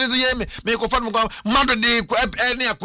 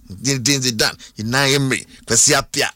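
ordinary delivery

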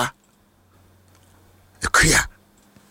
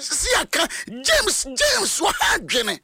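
high-pitched mock call of the name James; lightly acted crowd calling James; 3 dB quieter than usual. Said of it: "wɔn sɛ".

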